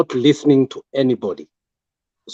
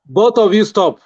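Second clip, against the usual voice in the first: raised high stern order; heated family shouting; 7 semitones higher, 4 dB louder than usual. Real, not acted. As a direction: shout to break up fight. Moderator halts both speakers.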